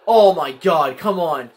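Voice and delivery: ordinary talk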